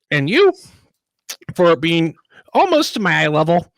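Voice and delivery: lightly voiced chirpy halfling; a hint of a peppy little halfling. Halfling character voice.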